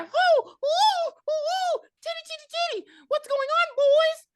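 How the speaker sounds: very high falsetto whooping; hammed-up frantic mom. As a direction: burst in hooting and calling the boys.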